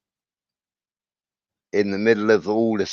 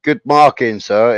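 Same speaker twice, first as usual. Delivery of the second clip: sarcastic praise; 3 semitones higher, 6 dB louder than usual. Retold or imitated. Sarcastic to teacher.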